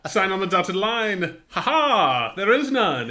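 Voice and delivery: ta-da like a cheap stage magician; skit magician. Pretend. A mock magician reveals an empty contract, hammed-up and laughing. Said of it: "our impression of Harry Kane's brother being a magician".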